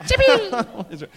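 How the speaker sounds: high startled cry of the name; yelp. Retold or imitated. Jimmy swats the dog, high laughing yelp. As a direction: squeal the name as you swat.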